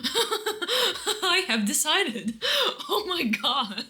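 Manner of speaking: laughing through the words, very slow; mock decree dissolving into laughter. Real, not acted. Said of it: "this makes me laugh maniacally".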